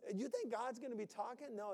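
usual speaking voice

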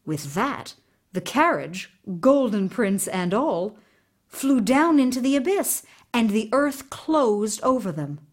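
plain reading voice

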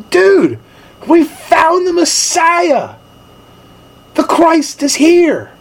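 high, slow, awestruck announcement; excited news. Retold or imitated. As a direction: tell your brother you found the Messiah, wide-eyed. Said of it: "he grabs Peter and he goes".

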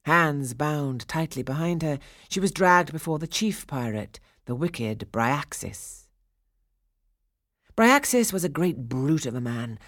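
plain reading voice

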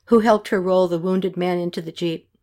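ordinary delivery

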